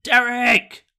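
calling out across the room; shouting over. Real, not acted. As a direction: call his name from a distance. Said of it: "I'm gonna shout over to Derek".

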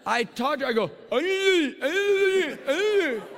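loud, high strangled "ah" cries; tongue-tied wail. Retold or imitated. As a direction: imitate someone straining to talk and only wailing. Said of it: "I can't speak English".

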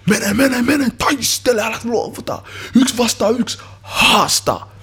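high, loud urging yell; full-voiced shout spurring a player on; shouting from the sideline. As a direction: yell it like a fired-up coach on the pitch. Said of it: "huusin siellä".

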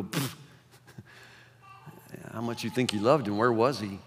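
mock the mourners' doubt quietly; scoffing whisper. Gossiping mourners scoffing at Jesus, hushed and sneering. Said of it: "other people go".

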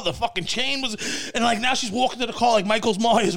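ordinary talk, nothing marked

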